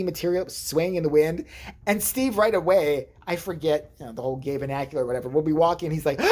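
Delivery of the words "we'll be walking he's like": normal talking voice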